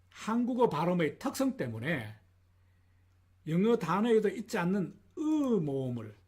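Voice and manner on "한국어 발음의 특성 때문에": ordinary talk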